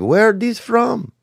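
a high, hammed-up French accent; mock French accent. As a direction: toss it off in a silly French accent. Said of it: "that was a French accent".

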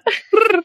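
squeaky gibberish lemur chatter; a lemur's high wailing cry. Pretend. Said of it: "can you give me your best lemur in-, uh, impression".

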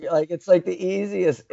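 usual speaking voice

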